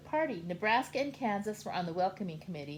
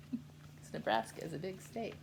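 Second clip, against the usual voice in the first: ordinary delivery, nothing marked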